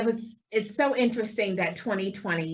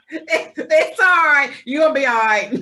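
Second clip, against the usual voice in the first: mock reassurance; 6 semitones higher, 8 dB louder than usual. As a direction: soothe him in a hammed-up way. Host mocks consoling.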